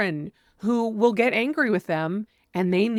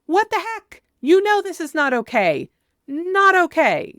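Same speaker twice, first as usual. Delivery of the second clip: much higher and louder, a sharp angry outburst; angry parent scolding; 7 semitones higher, 5 dB louder than usual. Shown as a demonstration. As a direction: parent scolding a child who misbehaved, openly angry. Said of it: "no, you can just say".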